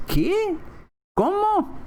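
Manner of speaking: very high, incredulous questioning; puzzled listener. Acted. Native listener thrown by the wrong accentuation, baffled. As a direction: act lost, voice shooting up in pitch. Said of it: "Y dice".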